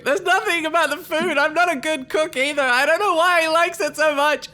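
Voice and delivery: high, weepy, tearful voice; weeping. Acted. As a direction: blubber it through tears. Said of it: "Greg is weeping and saying".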